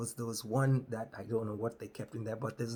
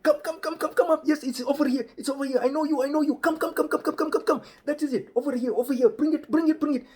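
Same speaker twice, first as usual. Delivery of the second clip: much higher, louder, excited calling; over-eager Rajiv; 14 semitones higher, 8 dB louder, faster than usual. Retold or imitated. Rajiv calling the bus in from the street, high and loud. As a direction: hype guy waving the bus in.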